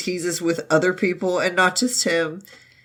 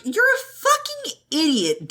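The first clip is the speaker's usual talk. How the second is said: high scolding voice; 12 semitones higher than usual. Retold or imitated. [name] voiced scolding.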